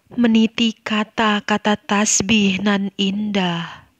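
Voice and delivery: slow, measured reading with long pauses between phrases; poetry recitation. Shown as a demonstration. Teacher reciting an example poem for her students, slow and solemn. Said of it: "Oke, Ibu contohkan ya".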